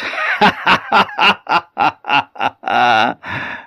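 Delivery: merman's laugh. Acted. The merman laughs at the wife's sweet greeting, eerie chuckle. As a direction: laugh knowingly at the wife's words. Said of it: "And then the merman laughed".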